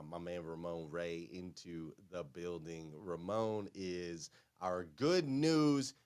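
ordinary talk

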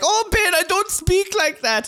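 very high mock-girl voice; hammed-up falsetto complaint from a Dutch friend; imitated Dutch girl. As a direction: protest in a squeaky imitation of her. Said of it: "She'd be like".

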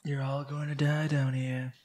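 hint of a cold AI threat, slowed; Red Queen. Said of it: "in a very eerie voice".

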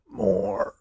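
high, weak, fading plea; feeble. A narrator's reading. Old man losing strength still asks for food, feeble. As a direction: let it out feebly, soft and high. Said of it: "this time feebly".

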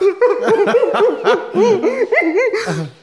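loud high mock wailing; imitated bawling. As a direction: act out helpless sobbing, loud and high. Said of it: "So I'm crying, hunched over".

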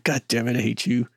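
soft, seething inner voice; retold suppressed anger. Captain silently cursing promoted former lieutenant, mock seething. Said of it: "the friction in his face".

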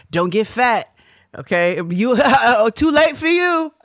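put-on laughing tease of an older relative; teasing auntie.

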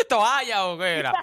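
asked through real laughter; laughing question. Real, not acted. Host teasing a caller about his basketball days, laughing. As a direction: tease him while cracking up.

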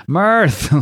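half-voiced mock yell for help; mock scream. Imitating Kevin calling Mirth after a punch, mock scream. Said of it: "he just screams".

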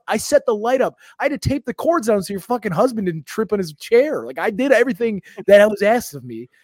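exasperated self-defence; indignant rant. Retold or imitated. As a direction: pile up the grievances, voice climbing. Rant listing all he did.

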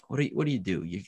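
normal talking voice

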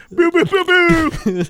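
high, drawn-out mock air horn; air horn imitation. Therapist kicking a client out, high air horn imitation. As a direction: honk out a slow air horn blast. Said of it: "she played an air horn".

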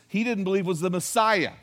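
normal talking voice

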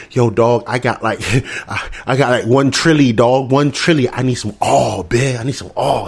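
low, halting street-corner begging; crack fiend. Acted. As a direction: plead for a fix in a low mumble. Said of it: "Joe Byron's like a crack fiend".

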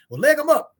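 a cowboy calling out across the pen; retold loud call. Cowboys urging caution.

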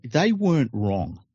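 ordinary talk, nothing marked

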